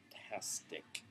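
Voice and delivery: breathy whisper under the breath; whispered aside. Real, not acted. Vlogger to viewers, a whispered delighted aside. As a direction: whisper it like a secret thrill. Aside about Matt Wagner script.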